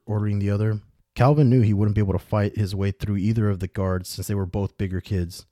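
usual speaking voice

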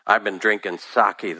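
ordinary delivery, nothing marked

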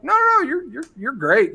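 high, hasty denial; acted-out nervous reassurance; flustered reassurance. Hastily reassures the offended dancer.

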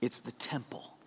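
hushed whisper of the answer. Shown as a demonstration. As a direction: whisper it like a secret. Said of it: "What is that?".

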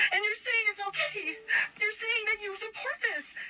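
crying through the words. Real, not acted. Mother recounting the principal meeting, crying. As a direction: voice breaking into tears, pitched high. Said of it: "but now I am crying".

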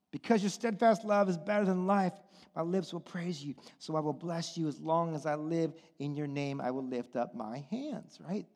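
usual speaking voice

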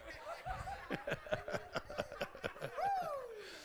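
very high, soft laugh; high giggle. Real, not acted. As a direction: let the giggle roll out. Laughing at own joke.

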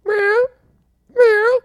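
very high little meow, rising; cat meow. Retold or imitated. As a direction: imitate a cat asking for attention. Imitating the cat waking her owner.

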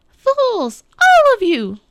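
high, loud, furious witch screech. A narrator's reading. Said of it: "the witch screeched".

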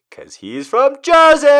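high, loud gleeful shout; excited punchline. Retold or imitated. Streamer proud of own joke, loud gleeful shout. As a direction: crow the punchline with delight. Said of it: "I was like".

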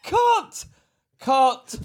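high, sharp call to stop; calling cut. Real, not acted. Co-host cutting off the recording over a joke, calling cut. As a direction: call a halt to the take.

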